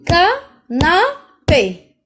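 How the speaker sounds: "canapé" slow and loud, ca-na-pé, pauses between; syllable by syllable. Shown as a demonstration. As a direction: split "canapé" into three drawn-out beats. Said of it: "La première syllabe".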